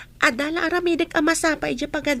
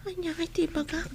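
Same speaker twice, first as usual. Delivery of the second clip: whisper; 4 semitones higher, 8 dB quieter than usual. Acted. Shy schoolgirl aside to herself.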